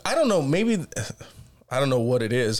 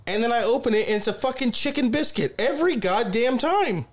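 exasperated rant; 8 semitones higher than usual. Real, not acted. Telling his friend about the drive-thru, exasperated. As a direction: pitch climbing high with outrage. Ranting about the wrong order.

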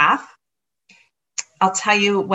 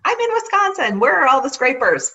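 high, louder, can't-believe-it complaint; exasperated disbelief; 7 semitones higher, 4 dB louder than usual. Retold or imitated. To the front desk woman, high louder exasperation. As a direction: throw it up in the air, how can this be. No scraper at the hotel.

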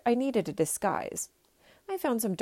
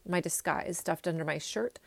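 ordinary delivery

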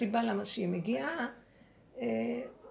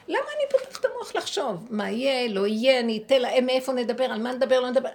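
agitated rant; 3 semitones higher, 9 dB louder than usual. Retold or imitated. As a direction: throw up your hands at your own overthinking. Friend's agitated thoughts, acted.

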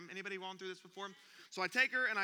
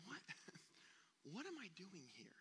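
whispered with a little laugh; bewildered whisper; 15 dB quieter than usual. Retold or imitated. Dad describing everyone's lost moment, whispered. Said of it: "we've gone".

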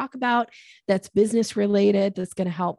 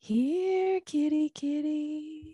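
high, soft, slow coaxing call; cat-calling voice; 8 semitones higher, 3 dB quieter, much slower than usual. Shown as a demonstration. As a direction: coax a shy cat out gently. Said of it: "how you call a cat".

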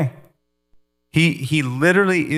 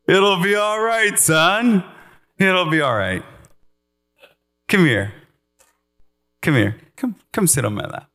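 higher, gentle reassurance to a son; comforting father; 6 semitones higher than usual. Pretend. God inviting his son onto his lap, a hint of tender reassurance. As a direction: soothe a worried child, lightly voiced.

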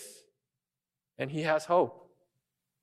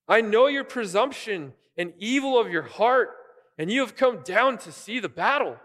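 higher, louder scolding big brother; annoyed big brother; 6 semitones higher, 4 dB louder than usual. Retold or imitated. Big brother calling out David, loud and annoyed. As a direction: scold a younger brother who showed up uninvited. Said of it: "he's really annoyed".

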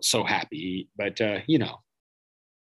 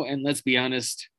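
ordinary delivery

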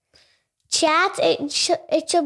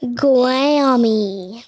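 drawn-out, clearly audible answer; said to be heard. Real, not acted. Child told to let people hear, speaking up. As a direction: speak up and stretch the word. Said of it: "say it where people can hear you".

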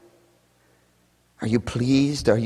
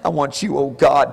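ordinary delivery

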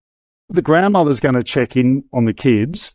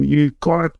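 pushy police officer; 5 semitones higher than usual. Retold or imitated. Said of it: "this overzealous police officer was hassling Kim".

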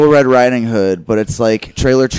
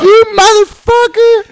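much higher and louder, slow shocked yell; mock furious shriek; 7 dB louder, slower than usual. Retelling a movie scene, the girl's hammed-up outraged shriek. Retold girl lashing out.

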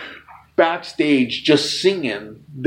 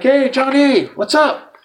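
eager greeting; 11 semitones higher, 3 dB louder, slower than usual. Retold or imitated. Greeting his idol.

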